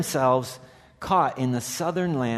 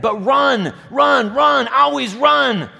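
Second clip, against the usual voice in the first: high, loud cry of run; urgent shout; 12 semitones higher, 10 dB louder, slower than usual. Acted. Hermit urging exhausted Shasta onward, shouting. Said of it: "hermit's command".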